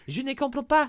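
put-on high, hammy French accent; mock French accent. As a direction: say it like a confused French student. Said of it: "accent is too strong".

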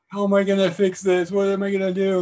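high, loud mock-bawling kid; crying. Kid who just broke his mom's favorite lamp, crying. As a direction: wail like a panicking little kid. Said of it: "like, crying and snot's running down".

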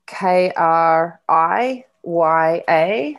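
slow letter-by-letter spelling of K R I Y A. Shown as a demonstration. To listeners who want to look it up, slow spelling. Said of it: "if ever anyone wants to look that up".

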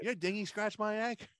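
lightly put-on affronted boater; movie quote. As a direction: echo a comic film complaint. Co-host echoes the movie line.

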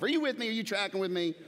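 usual speaking voice

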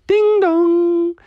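high, loud two-note chime; doorbell imitation. Family rings their own doorbell, imitated chime. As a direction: ring it like a doorbell.

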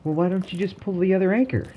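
high-pitched voiced companion; woman's suggestion. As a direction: lift the voice high to play her. Rhonda's suggestion voiced by teller.

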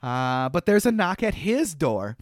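normal talking voice

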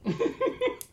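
short high chuckle; light laugh. Real, not acted. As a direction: laugh at the joke. Narrator laughs at joke.

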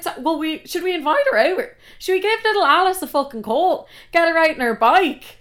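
high-pitched, biting fake suggestion; mock-eager sarcasm. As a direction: propose it with scathing false enthusiasm.

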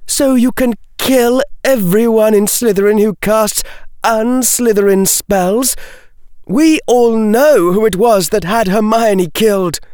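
high-pitched hostile outburst; angry accusation. A narrator's reading. Daphne accusing the intruder of Hermione's death, angry. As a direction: accuse him high and heated.

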